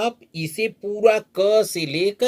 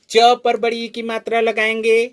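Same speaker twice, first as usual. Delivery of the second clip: normal talking voice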